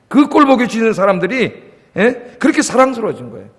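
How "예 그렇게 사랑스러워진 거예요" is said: normal talking voice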